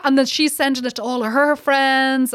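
ordinary delivery

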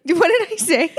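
giggling at her own mix-up; laughing question. Real, not acted. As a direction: ask while cracking up.

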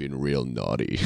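low, slow gravelly Santa; deep, quiet gravelly Santa voice; David Harbour. Pretend. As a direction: drop right down into a gruff growl. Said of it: "a gravelly David Harbour voice".

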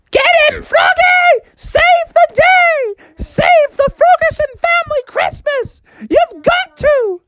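squeaky rallying cry; mother frog urging Froggy, very high shout; very high cheering mother. Acted. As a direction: cheer him on desperately.

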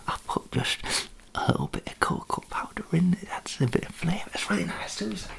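soft unvoiced whisper; hushed ASMR talk. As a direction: whisper it close to the mic. Whispered cooking chat.

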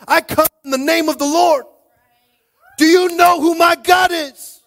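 high, loud, impassioned challenge; defiant shouting. Acted. David telling Goliath whose battle this is, defiant shouting. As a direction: shout down the enemy as God's messenger.

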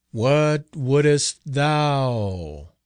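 very slow, hollow spectral voice; hollow faltering ghost. A narrator's reading. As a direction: drag it out like a weary ghost. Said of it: "in a hollow, faltering tone".

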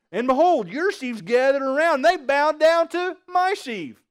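grand dream-telling. Acted. Joseph tells his brothers they bowed to him, grandly hammed up.

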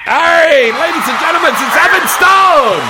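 big game-show announcer intro; announcer introduction. Acted. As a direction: hype up the guest like a ring announcer. Host introduces guest like announcer.

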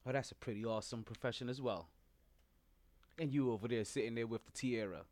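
low, quiet teacher voice; a nervous sub calling on kids; flustered substitute teacher. Acted. Teacher voice continues.

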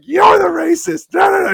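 high, loud acted outburst; mock shouted accusation. Retelling a row over a hat, mock shouting. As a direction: shout back in comic outrage.

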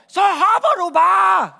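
high, drawn-out shout across a chasm; full-voiced long-distance call. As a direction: holler it slowly to someone far away. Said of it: "står på den anden side af en eller anden kløft og råber".

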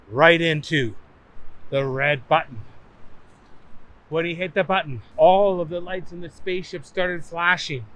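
ordinary delivery, nothing marked

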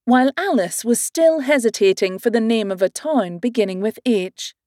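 ordinary delivery

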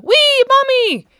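very high, loud, slow squeal of delight; a little girl thrilled to see her mother; enthusiastic squeal. A narrator's reading. Girl greeting her mother home, squealing with joy. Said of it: "an enthusiastic kiss".

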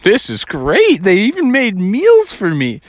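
hammed-up glee at a free feast; pleased bear, pitch climbing; delighted bear. Bear delighted at abandoned food.

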